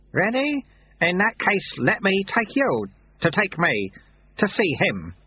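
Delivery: put-on a kindly old sweeper, amused; Lu Tse's voice. A narrator's reading. Lu Tse joking.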